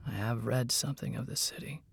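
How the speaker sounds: soft, hushed mage reply; whispering voice. A narrator's reading. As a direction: answer very quietly, almost whispering. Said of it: "in his whispering voice".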